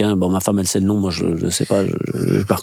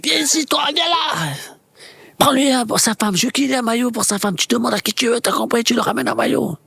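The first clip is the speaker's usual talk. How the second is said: high, urgent call across the room; 11 semitones higher than usual. Retold or imitated. Makelele ordering the kit man to fetch a shirt, high calling voice. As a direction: summon the kit man, ordering him about. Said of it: "il appelle le magasinier".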